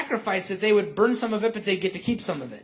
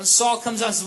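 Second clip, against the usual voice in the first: ordinary talk, nothing marked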